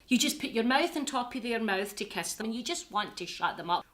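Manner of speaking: ordinary talk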